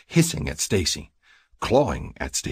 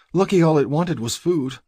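high, louder female character voice; shaken woman; 11 semitones higher, 5 dB louder than usual. A narrator's reading. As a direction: pitch it high and unsettled. Stacy speaks after the scare.

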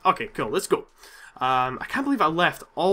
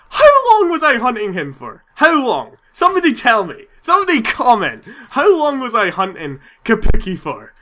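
louder, higher frustrated demand; exasperated rant; 4 semitones higher, 7 dB louder than usual. Real, not acted. Loud frustrated plea. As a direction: gamer demanding answers from viewers, exasperated. Ranting to viewers after dying.

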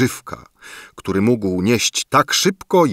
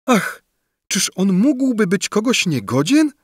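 high, outraged cry of disbelief; indignant exclamation; 12 semitones higher than usual. A narrator's reading. Różyczka defends her beloved, high indignant cry. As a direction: gasp it out in protest, high. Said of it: "wykrzyknęła Różyczka".

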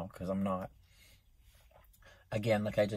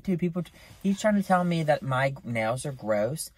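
ordinary talk, nothing marked

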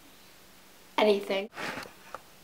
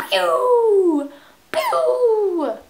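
high, falling game noise; imitated pew; 10 semitones higher, 4 dB louder than usual. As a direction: mimic a sliding electronic pew. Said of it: "It goes".

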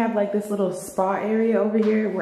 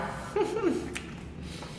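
breathy laugh; 12 semitones higher, 9 dB quieter than usual. Real, not acted. Laughing at own eating.

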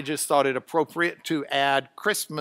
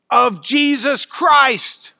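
"of Jesus Christ" loud, high, slow; emphatic phrase; 6 semitones higher, 7 dB louder, much slower than usual. Shown as a demonstration. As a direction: call out "of Jesus Christ" with weight. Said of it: "your first word for emphasis".

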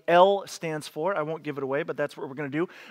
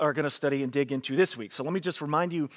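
ordinary talk

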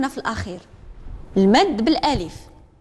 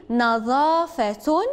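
slow model reading; much slower than usual. Shown as a demonstration. Teacher models نظافة for pupils to repeat, slow and drawn out. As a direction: draw نظافة out slowly, syllable by syllable. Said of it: "رددوا من بعدي".

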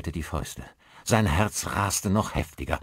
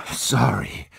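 panting; 3 semitones higher, 6 dB louder than usual. A narrator's reading. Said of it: "keuchte er".

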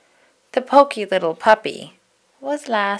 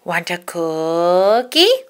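slow, unlike own voice cookie monster; 3 dB louder, much slower than usual. Acted. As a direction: offer it slowly like a hungry monster. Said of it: "said Cookie Monster".

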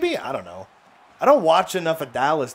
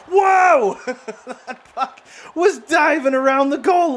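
shocked shout; 12 semitones higher, 5 dB louder than usual. Real, not acted. Puck diving around goal line.

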